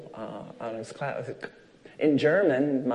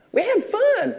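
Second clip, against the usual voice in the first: put-on child voice; 6 dB louder, slower than usual. Child voiced in retold story.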